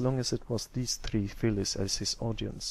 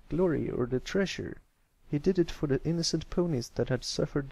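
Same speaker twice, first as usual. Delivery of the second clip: higher, lively tale-telling voice; storyteller voice; 5 semitones higher than usual. Acted. Colt tells the fillies his pirate story, lively. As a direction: tell the ending like a proud bard.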